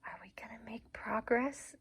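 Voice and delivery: soft unvoiced whisper. Real, not acted. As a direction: whisper so the puppy stays calm. Whispering near shy puppy.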